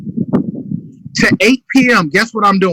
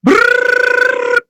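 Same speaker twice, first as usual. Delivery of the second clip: high mock ringing trill; a trilled ring sound; phone ring. Shown as a demonstration. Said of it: "I'm calling them".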